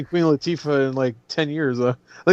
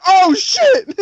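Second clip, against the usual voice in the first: high, loud mock yell; startled imitated outburst; shocked yell; 6 dB louder than usual. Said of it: "He's like".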